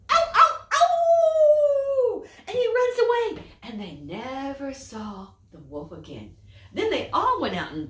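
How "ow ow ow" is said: very high loud mock yelps; yelping in pain. Wolf whose tail caught fire, high loud yelps. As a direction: yelp like a burnt wolf. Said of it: "and he goes".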